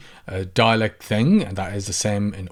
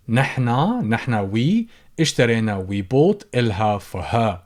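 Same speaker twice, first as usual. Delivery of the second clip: ordinary talk, nothing marked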